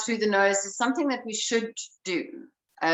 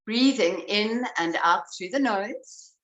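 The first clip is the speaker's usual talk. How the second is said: calm, unhurried guiding voice; breath coach easing the class into awareness; guided breathing. Acted. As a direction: lead them gently into noticing the breath.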